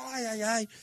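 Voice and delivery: high, held-back imitation of wailing; mock cry of pain. Retelling the marker on the floor, mock wail. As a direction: act out the injured player moaning. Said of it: "así gritando".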